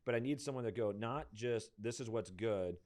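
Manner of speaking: usual speaking voice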